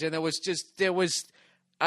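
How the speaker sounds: normal talking voice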